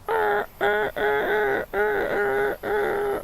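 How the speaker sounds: high falsetto ah-ah-ah like radio static; mimicking static crackle in falsetto; static noise imitation. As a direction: chop a high squeal into bursts. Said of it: "mimicking the, like, you know".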